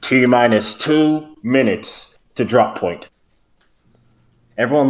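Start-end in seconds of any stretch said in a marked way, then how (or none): 0.0-3.1 slow, announcer-style countdown; ship announcement. Acted. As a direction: read it like a ship intercom.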